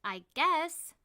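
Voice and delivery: tentative I guess with a questioning lift. A narrator's reading. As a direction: offer it hesitantly, not committing. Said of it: "just as tentative as him".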